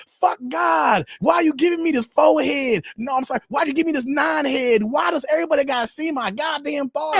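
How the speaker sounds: hammed-up outraged shout; mock furious rant. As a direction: yell it like a woman furious at her own forehead. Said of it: "Be like".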